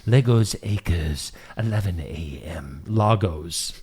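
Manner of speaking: soft, hushed golf commentary; golf commentator. Acted. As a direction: hush it like a golf broadcast. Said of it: "You should talk in a golf voice".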